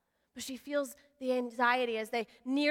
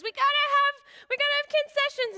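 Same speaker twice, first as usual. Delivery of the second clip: put-on kid's voice; 11 semitones higher, 4 dB louder than usual. Son at the theater begging dad, high kid voice. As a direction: play the eager kid, pitched way up. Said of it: "and then Max says".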